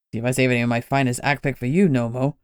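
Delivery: a touch of grumpiness; grumpy. Acted. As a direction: mutter it like a sulking old man. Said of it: "Lenny said grumpily".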